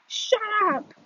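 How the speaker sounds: high yell at the bullies. Acted. Boy yelling at the laughing bullies, shouting. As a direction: yell it over their laughter. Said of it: "I yell".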